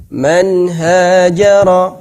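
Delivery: Man hajara slow, clear nun; Quran recitation. Shown as a demonstration. Teacher giving tanwin before ha example. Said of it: "tidak dibaca panjang nunnya".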